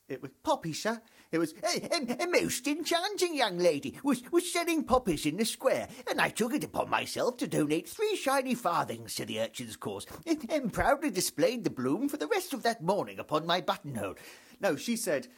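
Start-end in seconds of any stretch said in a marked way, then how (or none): 0.4-1.0 cheery Cockney street seller, a touch higher; chirpy Cockney poppy seller's call; Cockney accent. Retold or imitated. Comedian imitating a Cockney poppy girl in Cockney accent. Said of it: "She probably didn't have a Cockney accent". 1.6-14.1 put-on much higher, florid old-fashioned gentleman narrator; Victorian storyteller. Comedian mocking an Oliver Twist scene with pompous Victorian narration. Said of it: "It wasn't a scene out of Oliver Twist".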